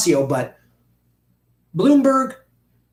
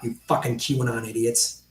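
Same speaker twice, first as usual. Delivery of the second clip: ordinary delivery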